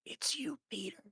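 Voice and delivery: soft, breathy whisper of recognition; awed whisper. Retold or imitated. Lost boy recognising Peter in Hook, whispered in awe. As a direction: whisper it in quiet wonder. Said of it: "looks at him and goes".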